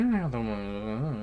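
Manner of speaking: slow, slurred mock mumble; imitated muttering. Drugged boyfriend dodging his girlfriend about money, mock muttering. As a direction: drag it out as an indistinct mumble. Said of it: "he's just muttering".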